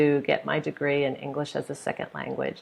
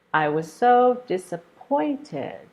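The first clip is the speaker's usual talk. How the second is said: disappointed with POINT raised high, slowly; slow sentence peaking on POINT in disappointed; third-syllable stress; 8 semitones higher, slower than usual. Shown as a demonstration. Teacher using disappointed in an example, slow, stressed on POINT. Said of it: "stress is on the third one".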